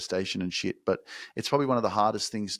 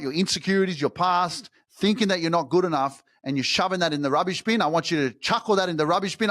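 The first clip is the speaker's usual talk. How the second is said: passionate push; 8 semitones higher than usual. Real, not acted. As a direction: urge them to throw it all away.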